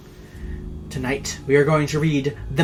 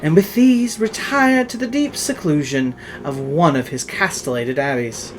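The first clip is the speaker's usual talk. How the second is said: louder, theatrical reading of a gothic tale; dramatic story narration; 3 semitones higher, 4 dB louder than usual. A narrator's reading. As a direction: tell it like a ghost story by candlelight. Reads the Poe tale aloud.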